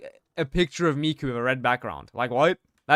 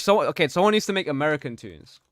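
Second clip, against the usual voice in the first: usual speaking voice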